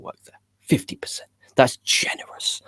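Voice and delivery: unvoiced sarcastic aside; hushed wry aside; whispered aside. Real, not acted. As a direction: whisper it like a confession. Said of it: "I'm a generous, generous man".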